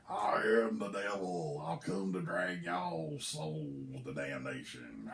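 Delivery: low, slow booming devil. Acted. Cousin in devil costume scaring the congregation, deep and slow. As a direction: drag each word down deep like a devil.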